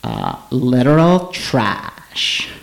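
very slow, put-on drag queen drawl; Evie Oddly character voice. Evie answering what she's wearing in a slow diva drawl. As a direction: stretch each word like a diva. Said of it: "asking Evie what she was wearing".

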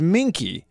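put-on the word minky with the short i sound of a French accent. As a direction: say minky instead of monkey, French style. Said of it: "the way he says it with his accent is minky".

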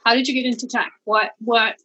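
tinny voice like through a tin can; talking through a cheap tin can mic; tin can phone. Real, not acted. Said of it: "I sound like I am screaming through a tin can".

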